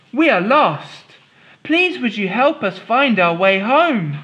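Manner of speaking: put-on a hopeful small girl; Molly's voice. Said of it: "said Molly".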